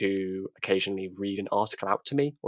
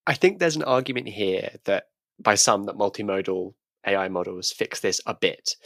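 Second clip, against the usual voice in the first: usual speaking voice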